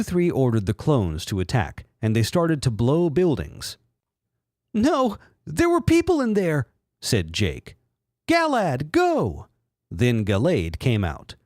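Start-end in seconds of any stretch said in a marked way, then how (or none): 4.7-6.7 very high mock falsetto alarm; Jake voice. 8.3-9.5 high falsetto battle call; Jake voice. Acted. Trainer calling Pokemon.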